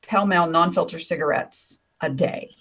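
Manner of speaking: normal talking voice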